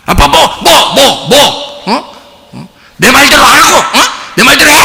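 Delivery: put-on a child ordering her dad around with a laugh; hammed-up bratty kid giving orders; bossy child. Child bossing father around.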